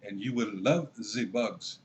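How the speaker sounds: put-on menacing German-accented decree, drawn out slowly; mock German accent. Host mocking Klaus Schwab's quote in a German accent. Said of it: "My attempt at a German accent".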